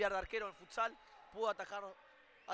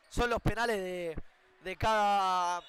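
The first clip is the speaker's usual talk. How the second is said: ordinary talk, nothing marked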